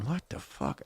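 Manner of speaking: very soft, baffled aside; hushed disbelief; shocked mutter. Retold or imitated. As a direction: barely voice it, as if thinking it. Muttered thought in awkward silence.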